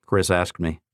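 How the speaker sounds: ordinary delivery, nothing marked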